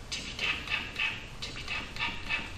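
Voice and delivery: soft whispered tippy tap patter; whispered rain sounds. A narrator's reading. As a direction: whisper the raindrops playfully. Rain sound in children's book.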